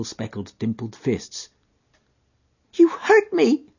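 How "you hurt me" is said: put-on high child's voice, hurt and reproachful; a small girl scolding the man who dropped her; reproachful little girl. Little girl reproaching the man, high child's voice.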